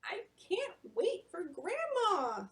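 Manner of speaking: put-on high, slow longing child voice; long for grandma aloud, slowly; Yumi's high, slow yearning; eager Yumi. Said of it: "says Yumi".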